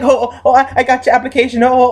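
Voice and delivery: put-on high, quick, teasing teenager; a hint of a giddy teenage [name]; mock silly teen. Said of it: "acting like he was a teen".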